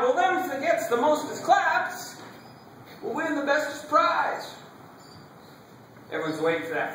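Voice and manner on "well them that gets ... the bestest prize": high-pitched, drawn-out proclamation; announcing judges. Acted. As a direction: call it out slowly to the crowd. Judges announcing contest rules to crowd.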